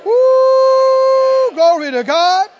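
loud, high whoop of praise; exultant shout. Real, not acted. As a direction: let out a long whoop, then cry praise. Exultant outburst after declaration.

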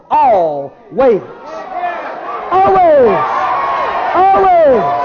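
high, drawn-out preacher's shout; emphatic shout. Real, not acted. Preacher shouts what God said to the congregation, emphatic. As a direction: belt it out to the whole congregation.

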